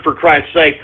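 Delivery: ordinary delivery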